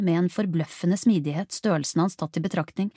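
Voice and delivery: usual speaking voice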